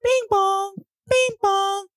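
very high bing bong chime; ding it like a doorbell; chime imitation.

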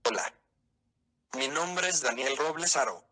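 normal talking voice

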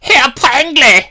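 put-on loud, camp German accent, very high; slow, squealed mock-German exclamation; high-pitched German. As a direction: shriek it in a hammed-up German accent. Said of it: "Your German is always so high-pitched".

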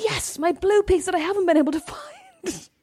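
high, thrilled mock squeal; retelling finding the jigsaw piece, excited high squeal. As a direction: squeal over the found piece. Retold excited find.

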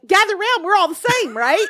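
high-pitched, chummy rallying call; mock millennial. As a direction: mimic a millennial gathering everyone round. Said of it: "her being like".

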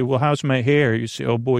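ordinary delivery

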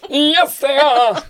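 high loud mocking squeal; squeaky yes. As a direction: parody her squeaky excitement. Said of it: "mocking the squeaky yes".

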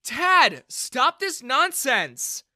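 loud rebuke. Acted. Daughter stops father's prank.

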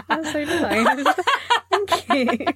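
laughing through the thanks; giggling as she says it. Real, not acted. Touched by compliment, laughing.

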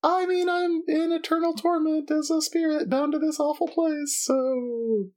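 high, drawn-out tormented spirit; eerie ghost making small talk; ghostly voice. Acted. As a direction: lament in a high, wailing ghost voice. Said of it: "you hear a ghostly voice".

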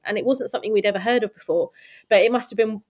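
normal talking voice

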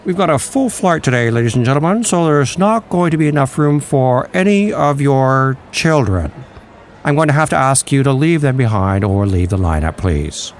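cheerful airline announcer with terrible news; airport announcement. Pretend. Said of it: "I think it's gonna be more like".

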